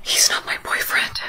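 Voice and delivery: hushed, voiceless whisper; secret whisper. Acted. Captive confiding in shop worker in fitting room, secret whisper. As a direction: whisper it so only one person hears.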